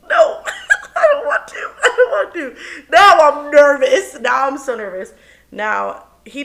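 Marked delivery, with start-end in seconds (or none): 0.1-2.6 high, laughing no; laughing refusal. Real, not acted. 2.9-5.1 laughing nervous. Real, not acted. Nervous reaction while laughing.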